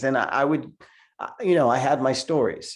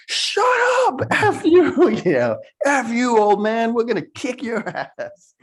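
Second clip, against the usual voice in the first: much higher, louder bratty yell; mocking teen yell; 12 semitones higher, 7 dB louder than usual. Kids on the bus yell at the man, shrill high yelling. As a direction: yell it back like rude teenage kids.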